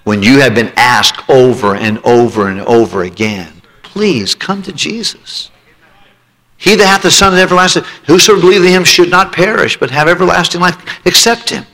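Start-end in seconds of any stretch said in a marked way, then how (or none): 3.8-11.6 high, pleading altar call; pleading preacher. Acted. Preacher pleads with unsaved listeners, high urgent plea. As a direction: beg them to come forward.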